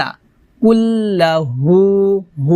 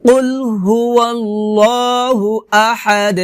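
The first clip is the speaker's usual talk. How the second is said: higher, slow held recitation; Quran recitation; 3 semitones higher, slower than usual. Shown as a demonstration. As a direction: chant the verse with long level vowels. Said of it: "আবার বলি".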